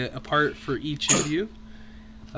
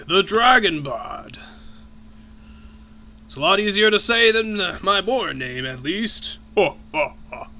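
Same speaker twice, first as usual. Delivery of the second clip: big, booming dragon voice, higher and louder; large booming voice; 8 semitones higher, 7 dB louder, slower than usual. Acted. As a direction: boom it out like a merry giant dragon. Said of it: "he greets you in a large, booming voice".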